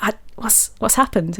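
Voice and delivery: whispered, puzzled question; hushed whisper. Retold or imitated. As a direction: whisper it, confused. Retold own confused reply.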